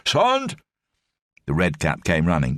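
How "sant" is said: shout. Acted. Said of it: "shouted into the corridor".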